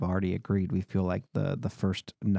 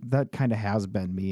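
normal talking voice

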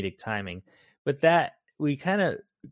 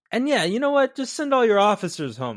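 much higher, louder mock voice; breezy acted suggestion; 11 semitones higher, 6 dB louder than usual. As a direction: pitch it high and loud, imitating the character. Acting out a suggestion to Renault.

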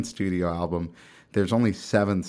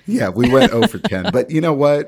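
loud, high, proud protest; laughing boast; 7 semitones higher, 10 dB louder than usual. Real, not acted. Soccer parent defending the winless team as coolest, exuberant boast. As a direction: crow it over the laughter.

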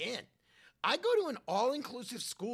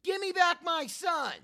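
high, loud movie-line yell; Mel impression; 9 semitones higher, 6 dB louder than usual. Kid auditioning for Mel's new movie with the practiced line, yelled high. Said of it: "I've been practicing really hard".

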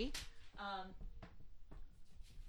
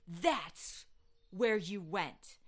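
ominous devil; much slower than usual. Retold or imitated. Devil confronting grandma, slow and ominous. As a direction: let it land like a sinister reveal.

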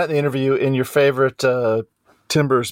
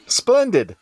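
mock British; 11 semitones higher than usual. Mock British after accent bit.

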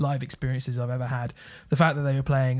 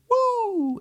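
a high whooping woo like a festival crowd; imitated crowd cheer. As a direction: whoop it up like the crowd singing along. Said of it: "the way that people go".

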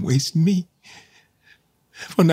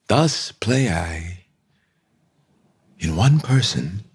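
slow; 3 semitones lower than usual. Acted. King reflecting alone in prison.

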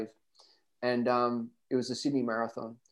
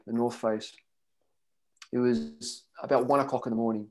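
normal talking voice

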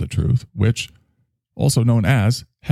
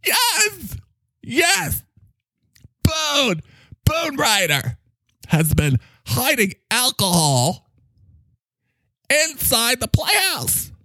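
higher, alarmed herald of doom; high, dramatic character voice; slower than usual. Acted. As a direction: announce the critics' invasion in a high, theatrical voice.

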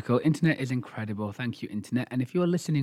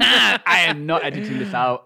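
loud, slow declaration through laughter; laughing; 5 semitones higher, 7 dB louder, slower than usual. Real, not acted. Podcast host teasing the co-host over a flub, laughing. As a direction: laugh while insisting, higher and louder. Laughing at co-host's fumbled segue.

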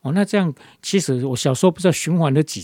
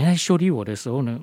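usual speaking voice

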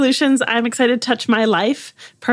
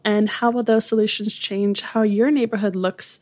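ordinary talk

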